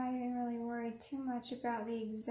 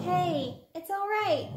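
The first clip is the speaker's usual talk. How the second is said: high, loud calming voice to a pet; soothing the dog; 6 semitones higher, 9 dB louder, slower than usual. Real, not acted. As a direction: hush a frightened dog, sweetly. Calming her startled dog.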